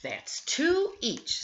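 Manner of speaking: high, slow sing-song count; children counting. Acted. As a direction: draw out the count, a little let down. Children count shared cookies.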